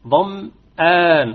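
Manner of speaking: ضمآن very slow, madd held two beats; short madd demo. Shown as a demonstration. Teacher recites the example word. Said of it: "لا يجوز فيه إلا القصر".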